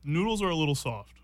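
talking with food in mouth; words round a mouthful; chewing while talking. Real, not acted. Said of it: "Sorry for the chewing sounds".

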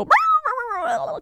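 high yappy barks; imitated dog bark. Retelling Toto finding Dorothy, mock high yaps. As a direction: yap like a small dog. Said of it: "we hear Toto go".

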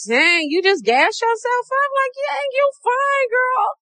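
put-on very high hype girl voice; high sassy hype. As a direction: gas yourself up, squealing high. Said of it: "you just be like".

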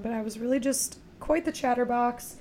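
usual speaking voice

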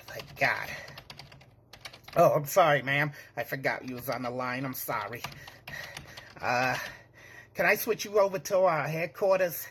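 normal talking voice

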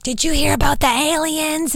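mock excited husband, pitched high; imitating husband. Husband on the phone asking her, high mock voice. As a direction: play Chad breathless on the phone.

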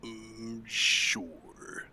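hushed, unhurried shark reply; slow shark character. Acted. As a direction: agree very softly, drawing it out. Halp answering in common tongue.

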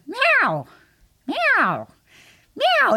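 high, insistent mock cat meows; cat meowing. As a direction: nag like a cat that wants food. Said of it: "it kind of transfers into".

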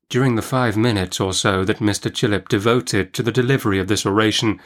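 ordinary delivery, nothing marked

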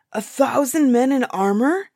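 high, loud horrified question; frightened giant's outcry; terrified. A narrator's reading. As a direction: echo it in alarm. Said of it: "The giant was terrified".

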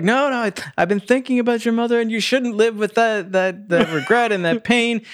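high-pitched, earnest imitation; urgent advice. Friend coming back out to advise Leaf, urgent and high. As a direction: plead it high, in a hurry.

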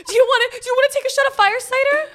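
high, loud pitch, laughing through; desperate saleswoman. Retold or imitated. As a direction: overeager vendor, breaking into laughs. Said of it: "coming out from our tent and be like".

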